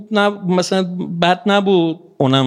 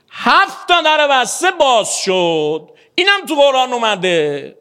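excited climax; 8 semitones higher than usual. A narrator's reading. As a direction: let the doors burst open in your voice. Story climax, doors fly open. (با هیجان و صدای بلند)

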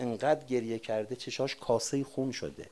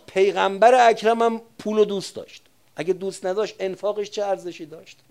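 louder, higher impassioned preaching; preaching at full force; 6 semitones higher, 9 dB louder than usual. Real, not acted. Preacher arguing to congregation that Hussein loved the world, loud fervent preaching. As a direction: drive the point home to the crowd.

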